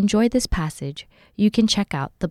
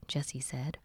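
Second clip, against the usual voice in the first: plain reading voice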